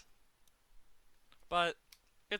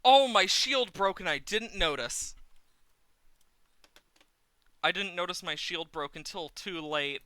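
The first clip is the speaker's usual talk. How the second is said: loud, high startled dismay; dismayed surprise; 7 semitones higher, 10 dB louder than usual. Real, not acted. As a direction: blurt it out in shock. Shield just broke in game.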